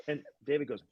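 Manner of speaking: usual speaking voice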